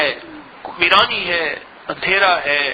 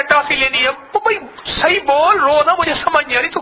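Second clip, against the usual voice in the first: mock crying child; 8 semitones higher, 4 dB louder than usual. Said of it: "بچہ نا روتے ہوئے کہتا ہے،".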